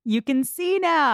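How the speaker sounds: high, loud burst of triumph; delighted exclamation. Real, not acted. Exclaims at friend's change of opinion.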